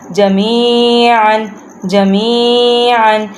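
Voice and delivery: slow "جمیعاً" with long ee and final "an"; full ending. Shown as a demonstration. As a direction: draw out "جمیعاً" and sound the closing n. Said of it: "تو ہم پورا حروف ادا کریں گے". ("جمیعاً" آخر میں نون کی آواز کے ساتھ)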